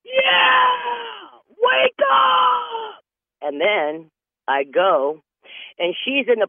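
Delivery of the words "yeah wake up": shrill, much higher screamed taunt, slow and drawn out; screeching wake-up taunt at full volume; yelling through the wall. Retold or imitated. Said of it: "She yells through the wall".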